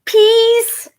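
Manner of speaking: put-on very high, loud baby plea; baby's cry. A narrator's reading. Baby brother begging for a puppy, high loud squeal. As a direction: squeal it like a tiny boy. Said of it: "cried Baby Louie".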